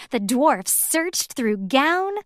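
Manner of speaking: usual speaking voice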